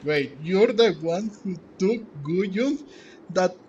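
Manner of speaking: ordinary delivery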